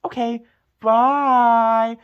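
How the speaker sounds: high, slow gushing goodbye; bubbly sign-off. Acted. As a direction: blow a kiss to the fans. Vlog sign-off kiss.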